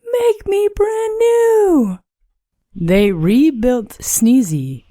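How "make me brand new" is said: very high, slow, strange puddle voice; a wavering little voice rising from a puddle. A narrator's reading. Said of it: "A voice from the puddle said".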